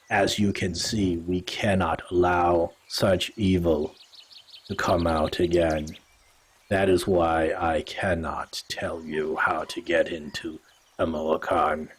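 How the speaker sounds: a grave cat-folk elder warning strangers; Kerber speaking. Acted. GM voices Kerber the tabaxi elder.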